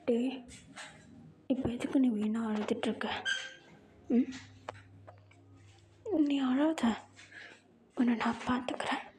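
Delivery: possessive lover. Acted. Jungkook voiced low, possessive. (தாழ்ந்த குரலில் உரிமை கொண்டாடும் காதலன்)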